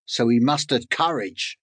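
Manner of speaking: usual speaking voice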